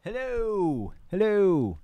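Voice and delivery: loud mic-test hello calls, pitched high. Real, not acted. Streamer testing his mic with a loud hello. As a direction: call out to test if the mic picks up.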